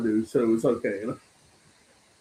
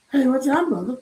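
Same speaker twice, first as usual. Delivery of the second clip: a lightly voiced, higher greeting; imitating Don; 11 semitones higher than usual. Imagining how Don answered the invite, lightly voiced greeting. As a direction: play Don breezily saying hi. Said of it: "He's like".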